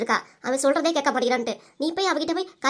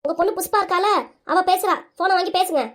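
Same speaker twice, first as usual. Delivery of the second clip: higher-pitched, insistent repeat; raised voice for poor hearing; 4 semitones higher than usual. Acted. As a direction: make the hard-of-hearing husband hear. Repeats for hard-of-hearing husband.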